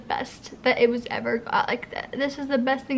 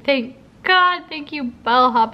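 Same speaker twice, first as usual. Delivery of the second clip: a relieved, louder thank God; higher, louder relieved cry; 2 semitones higher, 3 dB louder, slower than usual. Real, not acted. Grateful cry to the sponsor about movers. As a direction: thank God bursting out high. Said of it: "I'm so grateful".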